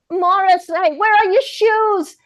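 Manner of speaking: high, slow call; lone voice in an ad. Shown as a demonstration. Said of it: "Say it again".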